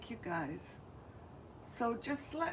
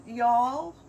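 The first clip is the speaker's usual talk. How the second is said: mock Southern accent; 8 dB louder than usual. Artist tries out what Amanda taught her, mock Southern accent. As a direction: ham up a Southern accent for one word. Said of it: "Amanda's been teaching me to speak ... with a Southern accent".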